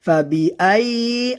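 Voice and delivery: "ফাবিআইয়ি" chanted with the voice running on; continuous sound. Shown as a demonstration. Teacher showing learners "ফাবিআইয়ি" without a break. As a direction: keep sound flowing through the double y of "ফাবিআইয়ি". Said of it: "আওয়াজ চালু থাকবে".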